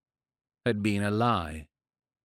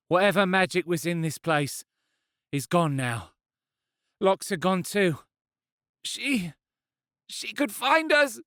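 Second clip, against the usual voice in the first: put-on worried young woman; 8 semitones higher than usual. Female character voice, worried.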